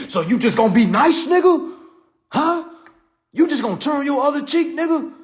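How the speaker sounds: high, loud mocking taunt; taunting critic. Critics taunting Martin Luther King for turning the other cheek, jeering. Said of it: "Them same folks tell Martin Luther King".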